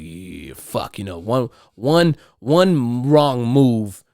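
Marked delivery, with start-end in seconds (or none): 0.0-1.0 under-breath curse. Retold or imitated. Quietly cursing at near miss.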